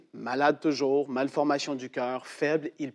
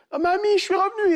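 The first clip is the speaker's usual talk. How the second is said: put-on relieved boy; 4 dB louder than usual. Boy returns to grandmother.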